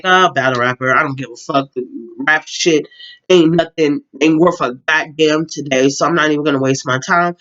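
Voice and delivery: low, unimpressed muttered thought; dismissive. Retold or imitated. Vlogger recalling her first reaction to a rap video, dismissive.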